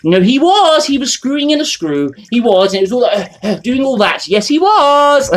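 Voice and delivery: high, mocking put-on voice for someone's lame excuse; mocking squeaky excuse. Mocking the excuse about late-night drilling in a high put-on voice. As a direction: pitch it up and sneer through the excuse.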